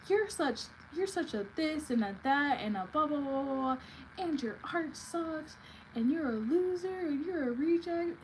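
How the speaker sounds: high-pitched imitation of an angry roommate; mocking, sing-song string of insults; mock ballistic rant. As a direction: mimic her tirade in a put-on high voice. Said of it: "then she went ballistic".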